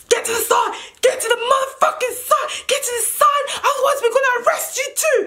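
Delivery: high, strained, furious command; police shouting orders. Retold or imitated. Policeman threatening to arrest her, angry high shout.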